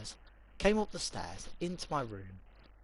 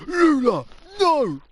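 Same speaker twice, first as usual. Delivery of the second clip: much higher, louder shout of the dog's name; sharp shout; 14 dB louder, slower than usual. Retold or imitated. Sister yelling at the dog, much higher and louder. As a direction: yell at the dog to stop. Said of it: "she went".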